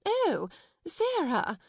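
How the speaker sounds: high, slow, drawn-out greeting; exclaiming. A narrator's reading. Miss Minchin caught annoyed by Sarah, exclaiming sweetly. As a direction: exclaim with strained pleasantness, pitch swooping. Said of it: "she exclaimed".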